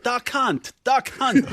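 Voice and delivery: put-on excited shout in an Italian accent. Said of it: "my Italian accent just came out".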